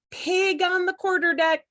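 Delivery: high, loud alarm call across the deck. A narrator's reading. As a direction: sound the alarm like a first mate. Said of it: "called first mate Scratch Whiskers".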